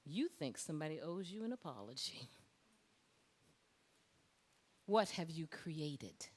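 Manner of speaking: a quiet inner voice calling someone to account; hushed divine challenge. Retold or imitated. Inner divine voice arrives, retold.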